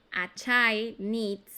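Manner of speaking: put-on very slow, spelling out a child needs; teacher dictating a child needs slowly; slow dictation. Shown as a demonstration. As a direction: drag out needs so the S is heard. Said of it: "Con S porque es tercera persona".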